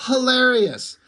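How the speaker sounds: usual speaking voice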